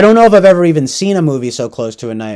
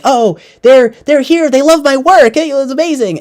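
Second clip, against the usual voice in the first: much higher, thrilled gushing; giddy writer; 10 semitones higher than usual. Retold or imitated. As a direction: gush like a flattered author. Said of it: "he's like".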